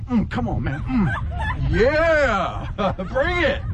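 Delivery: mock-tough dare from behind a brother; hammed-up swagger, rising high; a little kid playing tough; cocky taunt. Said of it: "And I was like".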